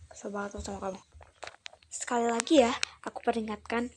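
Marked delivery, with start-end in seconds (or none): 0.1-1.0 quiet seething whisper; fed-up whisper. Acted. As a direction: whisper it through annoyance. Whispered frustration.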